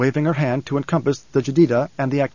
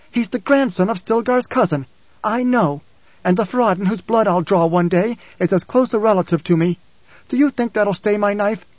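higher, insistent and hot; heated; 6 semitones higher than usual. Acted. Ghanima angrily rejecting kinship, heated and high. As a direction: press every point angrily.

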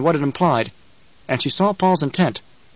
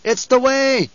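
high-pitched cry from the crowd; shout; 11 semitones higher, slower than usual. A narrator's reading. Someone in the throng insisting on custom, shouting. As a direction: shout it out in protest. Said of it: "someone shouted".

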